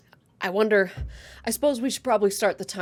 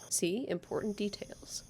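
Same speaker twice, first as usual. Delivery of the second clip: whispered aside, much softer; 10 dB quieter than usual. Real, not acted. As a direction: lean in and whisper it. Whispered joke aside to listener.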